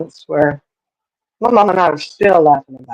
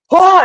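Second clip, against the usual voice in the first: sudden high yelp of fright; a short, sharp scream at the sight of the bug; startled scream; 13 semitones higher, 5 dB louder than usual. Retold or imitated. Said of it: "And he starts screaming".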